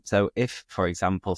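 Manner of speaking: ordinary talk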